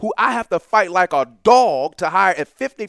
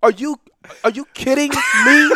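high, loud disbelief breaking into laughter; loud incredulity; 8 semitones higher, 6 dB louder than usual. Real, not acted. Guest scoffing at the athletic director's hiring, loudly incredulous. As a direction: cry out as if it's too absurd.

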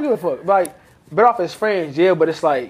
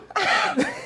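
high laugh; 12 semitones higher, 5 dB quieter than usual. Real, not acted. Laughs at own question.

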